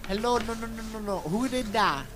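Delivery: ordinary talk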